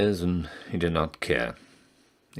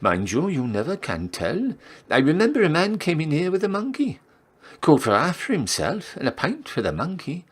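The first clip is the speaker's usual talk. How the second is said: chatty barmaid; 11 semitones higher than usual. Acted. Barmaid gossip about monkey man.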